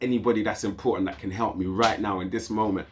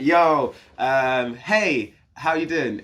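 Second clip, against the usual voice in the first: forced casual greeting; 5 semitones higher, 6 dB louder, much slower than usual. Retold or imitated. Calling sister at 4am.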